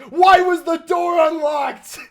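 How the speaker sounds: high, slow incredulous yell. Retold or imitated. Narrator at the car yelling at brothers, incredulous yell. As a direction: demand it at the top of your voice. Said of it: "the first thing I yell is".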